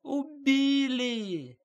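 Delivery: very high, long-drawn alarmed wail; woman shrieking the alarm from a window; wailing cry. A narrator's reading. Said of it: "заголосила".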